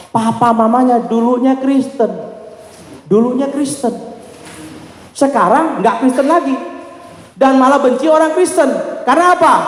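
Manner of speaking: high, punched pulpit delivery; emphatic preaching. Real, not acted. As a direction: drive each phrase home to the congregation. Preaches the revelation in raised voice.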